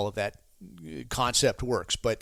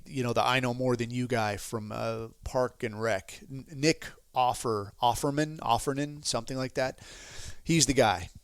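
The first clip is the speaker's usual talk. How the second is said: normal talking voice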